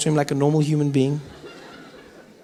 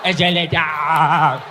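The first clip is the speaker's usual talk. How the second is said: slow awestruck marvel; much slower than usual. Acted. Comic marveling at a brand-new escalator, slow and awestruck. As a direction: draw out escalator slowly in wonder.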